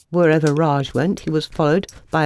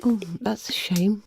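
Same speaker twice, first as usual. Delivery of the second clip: soft, sad sigh; quiet, disappointed mother; sadly; 4 semitones higher, 6 dB quieter than usual. A narrator's reading. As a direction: let it fall sadly and softly. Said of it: "said Pink Fedora sadly".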